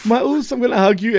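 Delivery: high-pitched, smiling mock greeting; Jimmy. Podcaster acts out wrestler greeting his brother, high mock-happy voice. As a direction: imitate a wrestler gleefully running in for a hug. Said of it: "like, smiling".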